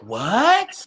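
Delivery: high rising "what"; mock-astonished, pitch climbing sharply; incredulous. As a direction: swoop up in exaggerated disbelief. Retold disbelief at people crossing street.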